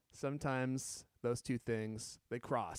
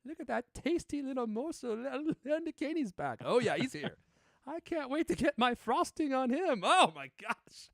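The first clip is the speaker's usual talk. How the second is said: high, swooning cartoon lady; flirty lady character; 11 semitones higher, 3 dB louder than usual. Host playing Missus You Know Who, ogling the guest, high and flirty. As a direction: coo over him like an eager admirer. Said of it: "cue up Missus You Know Who".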